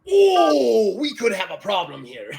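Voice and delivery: loud, high drawn-out "oh", then easing; comic pirate dismay; alarmed pirate. Acted. Teach realizes the Klingons are circling him, comic alarm. Teach sees trouble coming.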